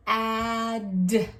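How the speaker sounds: "Ad" stretched loud with a released d; sounding out. Shown as a demonstration. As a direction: sound out "Ad" slowly, landing hard on the d. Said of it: "Let's sound it out".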